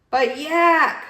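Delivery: high, loud wail. Acted. Walrus wails at the chatty taxi driver. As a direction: wail his name in despair. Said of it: "wailed Walrus".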